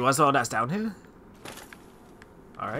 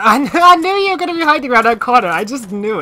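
excited; 9 semitones higher, 8 dB louder than usual. Real, not acted. Predicted the ambush, laughs.

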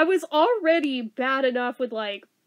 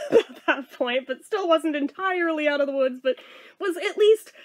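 ordinary talk

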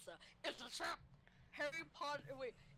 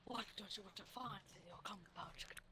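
whispered imitation; 6 semitones lower, 6 dB quieter, faster than usual. Copies the whispered reading.

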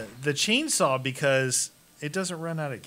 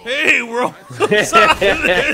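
slow, loud parroting of a game voice, laughing; imitating game character; 7 semitones higher, 12 dB louder, slower than usual. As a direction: drag it out while laughing.

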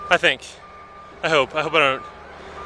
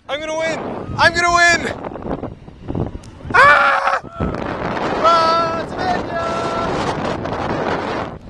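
excited gloating; 10 semitones higher, much slower than usual. Real, not acted. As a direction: shout victory mid-race. Racing Brian downhill.

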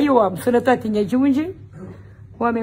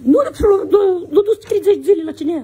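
high, loud outburst of indignation; indignant shout; 8 semitones higher, 6 dB louder than usual. Real, not acted. Man exclaiming to his neighbour about the jailed deputy, loud and indignant. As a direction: call it out, outraged.

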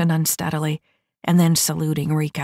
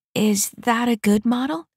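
higher, naive hopeful asking; hopeful question; 7 semitones higher than usual. A narrator's reading. New mech asking about her model, naive. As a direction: ask it like an eager kid.

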